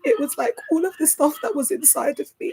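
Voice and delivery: high voice, close to crying; real emotion rising in pitch and volume; tearful. Real, not acted. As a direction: let it pour out through tears. Tearful memory of healing through music.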